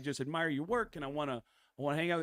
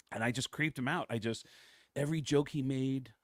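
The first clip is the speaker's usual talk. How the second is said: ordinary talk, nothing marked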